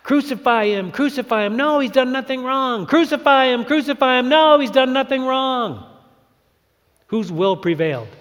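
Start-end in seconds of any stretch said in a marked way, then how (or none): 0.0-5.8 crowd and Pilate. Retold or imitated. Crowd versus Pilate, acted back and forth.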